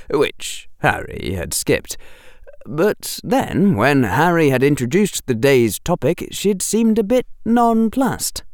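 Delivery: plain reading voice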